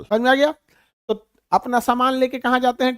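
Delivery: ordinary talk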